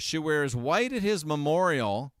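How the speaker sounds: normal talking voice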